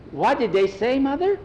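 put-on high, rising girl's question; worried, high-pitched asking; anxious daughter. Nancy anxiously questions her mother.

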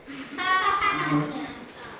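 high, thin witch cackle; high mocking laugh. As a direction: cackle high at the frightened boy.

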